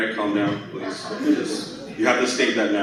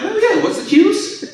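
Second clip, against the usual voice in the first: squeaky dad voice; slower than usual. Retold or imitated. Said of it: "He's sitting there like".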